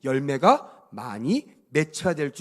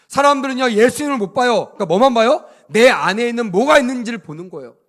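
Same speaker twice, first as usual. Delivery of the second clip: loud, rising preacher's exhortation; forceful preaching; 4 semitones higher, 9 dB louder than usual. Real, not acted. Preacher urging the congregation to bear fruit, forceful. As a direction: push each phrase out to the congregation.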